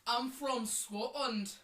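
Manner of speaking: slow put-on Scottish accent; mock Scottish native. Shown as a demonstration. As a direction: lay on a Scottish accent, drawn out. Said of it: "let's do our accents right now".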